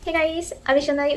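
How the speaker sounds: ordinary delivery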